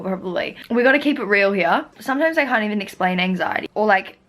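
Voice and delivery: ordinary delivery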